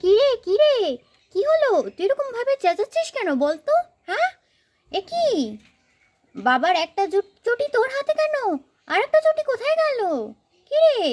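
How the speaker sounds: put-on high, startled questions to the child; alarmed mother. Mother startled by her child's screaming, alarmed and high. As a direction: rush in alarmed, voice way up.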